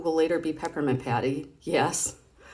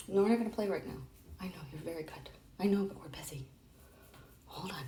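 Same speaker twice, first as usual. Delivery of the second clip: hushed aside; 2 semitones higher, 9 dB quieter, faster than usual. Real, not acted. Hushing the dog off-mic.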